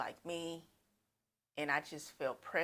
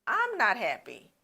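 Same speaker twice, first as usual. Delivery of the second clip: high and loud, putting her foot down; acted outrage, louder and higher; indignant protest; 8 semitones higher, 8 dB louder than usual. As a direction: stop him cold, offended. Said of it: "And then she's like".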